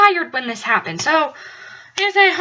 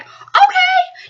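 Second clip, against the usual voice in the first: high squeaky okay; 14 semitones higher, 3 dB louder than usual. Retold or imitated. Roommate caught off guard, squeaking a high okay. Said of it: "was like".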